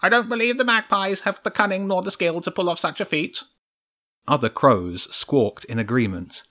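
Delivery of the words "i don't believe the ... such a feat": high, quick crow councillor; crow council member. A narrator's reading. Crow addressing the chairman, high and quick. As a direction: pipe up in a high voice.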